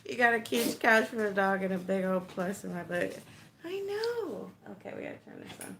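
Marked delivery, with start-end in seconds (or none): 3.6-4.5 high, drawn-out cooing to a dog; louder, high-pitched doggy voice; pet talk. Real, not acted. Talking to her dog.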